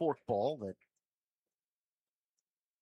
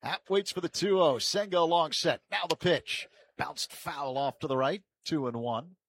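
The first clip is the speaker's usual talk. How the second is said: loud, raised build to the pitch, easing on the result; live play-by-play; 4 semitones higher, 6 dB louder than usual. Real, not acted. Announcer calling a foul ball, live play-by-play. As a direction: project the call over the ballpark.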